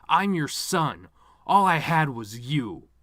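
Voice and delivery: loud, slow, breaking cry to his dead mother; anguished outburst. Acted. Son at his mother's funeral, loud anguished cry. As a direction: shout the hurt out at her.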